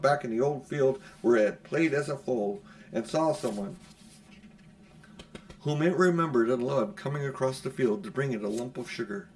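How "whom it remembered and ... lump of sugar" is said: ordinary delivery, nothing marked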